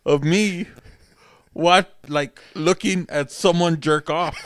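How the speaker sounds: high, loud words through real laughter; cracking up mid-rant; laughing while talking. Real, not acted. Laughing at a dumb habit.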